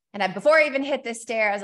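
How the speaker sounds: normal talking voice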